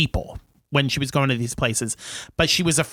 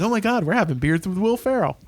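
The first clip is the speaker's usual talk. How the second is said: mock-excited bar patrons, pitched higher and quicker; super excited; 5 semitones higher, faster than usual. Bar patrons thrilled to drink with a celebrity, super excited. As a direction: imitate starstruck fans gushing in a rush. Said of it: "they get super excited".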